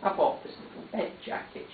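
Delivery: unvoiced, whispered and small; a whisper after crying; hushed, tearful.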